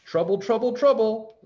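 high squawking repeat; squawking bird. A narrator's reading. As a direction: squawk it like a parrot.

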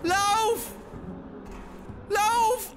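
frantic yelling while chased; panicked shout. Real, not acted. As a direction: scream at yourself to run. Fleeing the monster.

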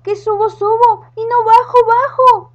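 high loud panicked witch cry; witch screaming for help. Acted. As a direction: scream for help while sliding. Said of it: "gritaba la bruja".

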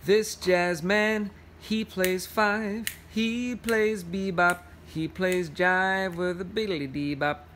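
slow, sing-song picture-book verse; reading to children. Acted. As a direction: lean into the rhythm of the rhyme. Reads picture book aloud.

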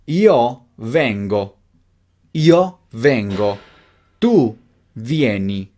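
slow, each word set apart; slow modelling. Shown as a demonstration. Teacher gives learners the conjugation to repeat, slowly.

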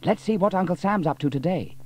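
keen to get going; a boy impatient to see his uncle; eager boy. A narrator's reading. James eager to visit uncle.

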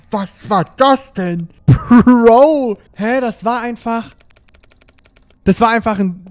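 high, loud disbelief after a scare; shocked and shaken. Real, not acted. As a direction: still reeling from the jumpscare.

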